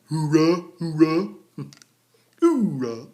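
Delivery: loud rising hoorah chant; Marine hoorah. Retold or imitated. Marines answering the instructor with loud hoorahs. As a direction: bark it out like the squad.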